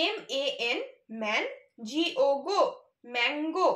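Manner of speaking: "এমএএন man, জিও গো", then the joined word "mango"; syllable by syllable. Shown as a demonstration. Teacher showing children how to read by syllables, "mango" built part by part. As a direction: spell and sound each part of "mango", pausing between man and গো. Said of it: "syllable ভাগ করে করে পড়ি".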